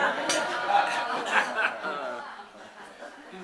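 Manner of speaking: a short rising laugh. Real, not acted. After the Churchill taxi story punchline, a short rising laugh. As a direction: laugh at your own punchline.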